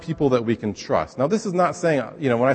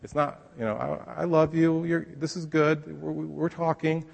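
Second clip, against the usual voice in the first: slow, higher, soft and comforting; nice soothing God; 5 semitones higher, 4 dB quieter, slower than usual. Pretend. Pastor mimicking a gentle God, slow and soft. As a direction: coo gently like calming a child. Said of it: "the nice".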